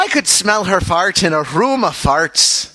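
lilting Irish brogue; Irish accent. Shown as a demonstration. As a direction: say it like a Dubliner. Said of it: "say the James Joyce thing again in an Irish accent".

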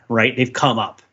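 ordinary talk

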